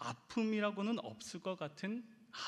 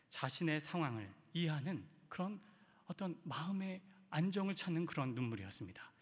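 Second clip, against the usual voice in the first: normal talking voice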